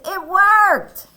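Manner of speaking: high, loud delighted exclamation; happy. Acted. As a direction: cheer as a relieved storybook Elephant. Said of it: "look how happy Elephant looks".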